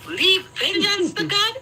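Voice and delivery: slow, high scornful echo; mocking echo. Scoffing at the advice to leave vengeance to God, high and slow. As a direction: drag it out in disbelief. Mocking repeat of friend's words.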